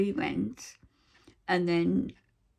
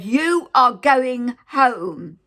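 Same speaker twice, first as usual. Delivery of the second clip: loud, slow, higher shout, each word hammered; retold shouting; 6 semitones higher, 11 dB louder, much slower than usual. Nurse shouting at the patient inches from her face, loud slow shout. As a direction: bark it right in her face, slowly. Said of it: "She was shouting her mouth off".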